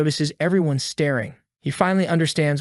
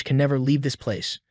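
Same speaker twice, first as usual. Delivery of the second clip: ordinary delivery, nothing marked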